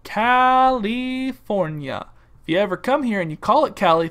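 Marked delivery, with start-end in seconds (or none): 0.0-2.1 California in long, separate syllables; mock local pronunciation. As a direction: stretch California slowly, falling at the end. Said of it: "as we here in Cali call it".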